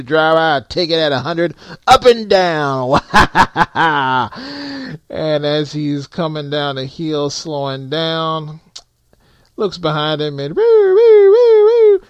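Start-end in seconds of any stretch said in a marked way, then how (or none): 0.0-3.0 boastful trucker. Retold or imitated. Trainer bragging on the CB. 3.1-5.0 drawn-out gloating laugh; gleeful laugh. Retold or imitated. Trainer laughing on the CB. 10.6-12.0 high, loud siren wail; siren imitation.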